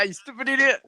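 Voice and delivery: high-pitched echoed catchphrase. Acted. Echoing co-host's wrestler impression, mock scolding. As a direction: play along with a wrestler's mock insult. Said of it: "as [name] would say".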